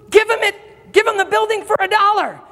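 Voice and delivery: high, excited command; acted urgent order. Owner telling his agent to sell, acted urgent order. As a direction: insist on it as the astonished owner.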